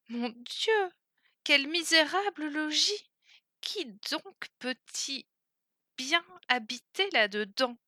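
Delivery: un mon Dieu dégoûté, la voix qui monte; la princesse horrifiée devant la cabane; exclamation consternée. Acted. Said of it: "s'écria la princesse". (la princesse s'écrie, consternée par le misérable logis)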